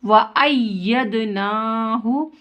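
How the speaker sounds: व अय्यदनाहु slow, holding the doubled y; firm tashdeed. Shown as a demonstration. Teacher showing the tashdeed, held double y in व अय्यदनाहु. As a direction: lean on the "yy" in व अय्यदनाहु. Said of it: "इसको जमा कर पढ़ेंगे".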